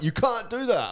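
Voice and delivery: high-pitched mock scolding; mock protest. Partner objecting to the voice, high mock protest.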